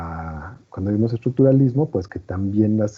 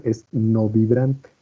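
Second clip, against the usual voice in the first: ordinary talk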